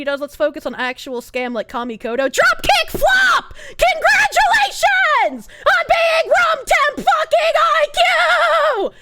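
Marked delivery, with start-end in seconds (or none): 2.3-8.9 high, slow, screaming rage; furious shout. Real, not acted. Streamer yelling at a chatter, furious scream. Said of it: "I'm so angry".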